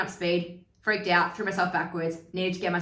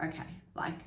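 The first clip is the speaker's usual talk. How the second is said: meek whisper; 12 dB quieter than usual. Retold or imitated. Retold meek agreement while upset.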